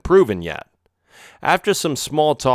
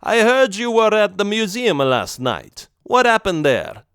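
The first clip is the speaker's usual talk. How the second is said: put-on higher mob boss greeting in a thick Italian accent; 9 semitones higher than usual. Vincenzo welcomes visitors, thick Italian accent. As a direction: roll it out like an Italian don. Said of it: "he said in a thick Italian accent".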